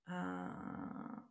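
ordinary talk, nothing marked

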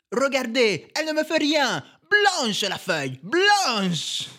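high, slow mock Italian art teacher; Italian accent. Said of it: "mon plus bel accent italien pour faire le prof d'art".

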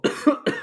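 dry breathy cough; choking cough. Real, not acted. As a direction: cough to clear a blocked throat. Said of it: "Je m'étouffe". (toux étouffée)

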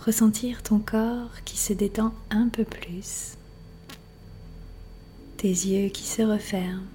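unhurried relaxation voice, letting silence settle; guided meditation. Acted. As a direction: guide the listener gently into rest, phrase by phrase. Leads a yoga nidra relaxation.

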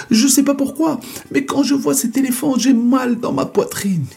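high anxious complaint; frightened whine. Acted. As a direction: whimper out the pain.

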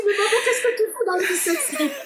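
very high mock kid voice; imitated son. Son asking mum about the show, high-pitched imitation. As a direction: pipe up high as a baffled son. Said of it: "Mon fils, il dit".

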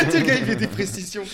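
high, giggling teasing line; laughing. Real, not acted. Teasing the friend for his precise details, laughing high.

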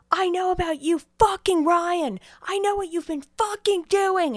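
high, loud mock yelling; retold screaming fight. Boyfriend screaming at his girlfriend about Ryan, mock furious yell. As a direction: act out a jealous tirade, hitting the swearing hard. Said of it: "They start screaming at each other".

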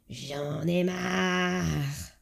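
slow, grumbling mutter. Retold or imitated. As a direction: mutter it under the breath, dragging. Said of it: "je l'entendais marmonner".

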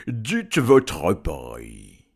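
very slow, loud dragon voice; bargaining dragon. Acted. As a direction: stretch out each word as a dragon. (voix de dragon, forte et très lente)

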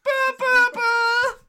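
high descending buzzer sound; wrong-answer buzzer. Acted. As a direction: sing out a wrong-answer buzz.

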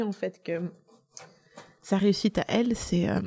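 usual speaking voice

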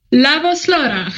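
"Lavos Laura" as a careful Lithuanian greeting; Lithuanian pronunciation. Real, not acted. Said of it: "very nice Lithuanian pronunciation".

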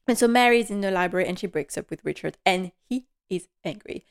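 normal talking voice

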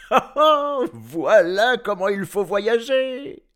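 loud, high, slow-stretched exultation; gleeful. A narrator's reading. Professor flying through the air, gleeful. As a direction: crow with glee, high and loud. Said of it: "se dit-il tout content".

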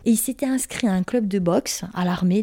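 normal talking voice

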